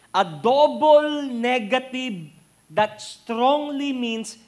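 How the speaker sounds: loud, high, slow emphasis; preacher pressing the meaning of never; emphatic preaching. Acted. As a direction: drive home each phrase slowly.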